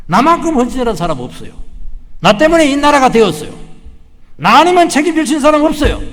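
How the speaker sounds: loud, high proud claims; arrogant boasting. Retold or imitated. Preacher mocks the servant's pride, loud boasting. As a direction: boast loudly, full of yourself.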